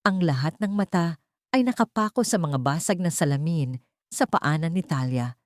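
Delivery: ordinary talk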